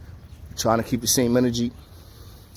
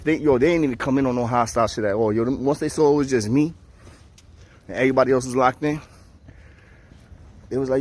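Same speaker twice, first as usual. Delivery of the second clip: usual speaking voice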